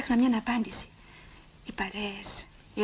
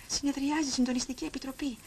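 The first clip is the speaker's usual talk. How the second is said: ordinary talk, nothing marked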